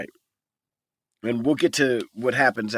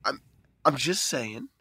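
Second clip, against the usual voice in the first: softer, hesitant proud protest; mock wounded pride; 8 dB quieter, slower than usual. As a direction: imitate a quietly stubborn complainer. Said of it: "with all of his pride".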